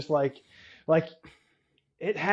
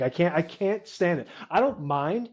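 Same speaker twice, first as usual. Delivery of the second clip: ordinary talk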